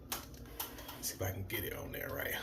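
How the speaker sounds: muttered under his breath; whispering. Real, not acted. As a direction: whisper it to yourself. Muttering to himself while working.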